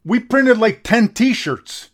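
vent, fed up with the cheap sponsor; incredulous grumbling rant; indignant rant. Real, not acted. Ranting about sponsor.

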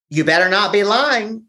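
high, pressing warning; stern mother. Retold or imitated. Mother pressing her son not to lie, high stern warning. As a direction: warn him hard not to lie.

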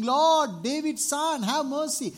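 high, loud plea for mercy; beggar crying out. Retold or imitated. Blind beggar calling to Jesus, a loud high plea. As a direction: cry it out across the crowd. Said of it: "This beggar was crying".

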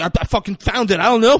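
high, loud flustered excuse; nervous protest. Retold or imitated. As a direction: blurt it like a cornered man, imitated. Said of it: "'cause he's nervous".

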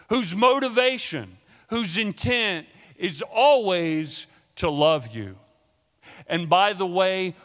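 fiery preacher calling the church to attention; preaching. Acted. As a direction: call out to the flock with full force. Preacher opening call to church.

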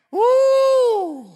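ecstatic preacher woo; high joyful whoop. Real, not acted. Preacher celebrating resurrection.

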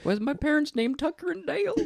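put-on high little-boy voice; young boy voice. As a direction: ask it like a small kid. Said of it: "[name] as a young boy".

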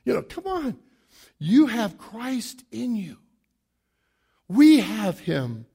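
normal talking voice

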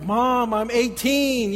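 mock teen indignation, stretched out; protesting teenager. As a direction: complain to your mother like an embarrassed teen. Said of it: "And I'm like".

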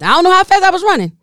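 excited gush about how fast she ran; breathless excitement. Real, not acted. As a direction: blurt it out at a rush, still caught in the sprint. Reliving the frantic sprint.

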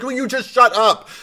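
mock exasperation, high and loud; exasperated outburst. Host acting fed up with Franklin's whining, mock outburst. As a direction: act fed up, voice pushed up and loud.

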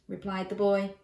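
plain reading voice

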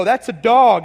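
loud, flat-out certain statement; certain declaration. Retold or imitated. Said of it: "You go".

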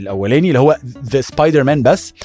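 low, know-it-all comic fan; nerdy fan explaining. Retold or imitated. Friend Heridy explaining comic universes, lightly low and lecturing. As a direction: lecture like a superfan who is sure. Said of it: "قال لك".

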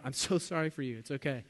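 normal talking voice

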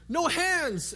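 high, loud show-off call; excited shout. Retold or imitated. Girl in the pool showing off to her family with a shout. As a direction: shout it to be noticed. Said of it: "And I said".

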